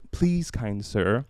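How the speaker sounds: ordinary talk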